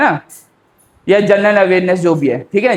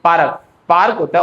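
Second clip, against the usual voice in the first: ordinary talk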